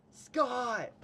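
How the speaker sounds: high, loud call across a distance; retold yell. Storyteller calling to Scott from far back in line, yelling. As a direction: yell out to get his attention. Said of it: "and I yell".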